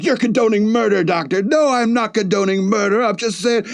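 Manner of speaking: mock argument. Heated argument between two characters.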